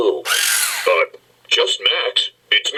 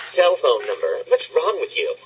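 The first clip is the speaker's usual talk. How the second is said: usual speaking voice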